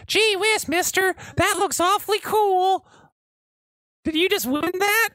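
put-on very high, eager little boy; gee-whiz child voice; wholesome 1950s kid. Two little kids voiced.

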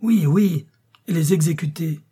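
eager shout of agreement; crying out. A narrator's reading. Angry men backing their ringleader, crying out. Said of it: "cria-t-il".

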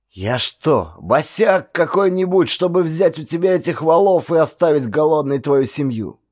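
proud, lightly angry refusal; indignant host. A narrator's reading. Koso indignant reply. (хозяин гневно отказывается, громче)

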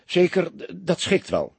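flustered, stumbling on d- before it; wavering, halting assent; hesitant. A narrator's reading. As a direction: stammer out the agreement reluctantly. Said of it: "zei hij weifelend".

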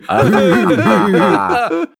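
high, jeering group-style laugh; high acted guffaw; boys' cackling guffaw. A narrator's reading. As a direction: cackle like mocking boys. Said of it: "Загоготали мальчишки".

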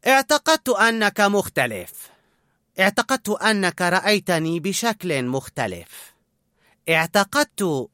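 hurt feelings pressing through; hurt resentment. A narrator's reading. Colette to Linguini, hurt and resentful. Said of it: "باستياء".

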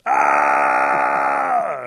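long, very loud "aah"; full-out startled yell; chief's scream. A narrator's reading. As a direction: scream in alarm at the coming kiss. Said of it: "the chief goes".

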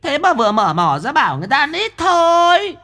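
very high, teasing woman's voice; mocking medium. Medium taunting Quý, very high and teasing.

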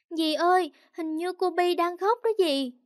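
put-on worried little kid speaking up; anxious child. A narrator's reading. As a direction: call auntie in a small high voice. Child calls aunt about crying baby.

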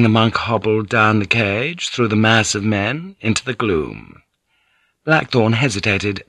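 plain reading voice

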